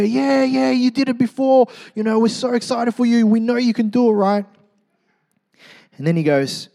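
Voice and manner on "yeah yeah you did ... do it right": very high, loud crowd cheer. Retold or imitated. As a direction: whoop him on, pitched way up. Crowd cheering him on again.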